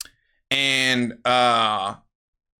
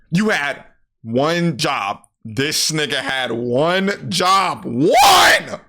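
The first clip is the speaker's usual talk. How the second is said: building angry rant; 4 dB louder, much slower than usual. Retold or imitated. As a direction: lose it at your friend, ending in a furious shout. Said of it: "Ian is like".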